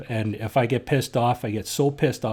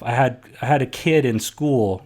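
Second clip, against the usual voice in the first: ordinary talk, nothing marked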